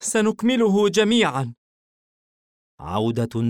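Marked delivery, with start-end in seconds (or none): 0.0-1.5 put-on high young girl voice; sweet little girl; girl's voice. A narrator's reading. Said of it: "اقتربت ثيشا معانقة أمها".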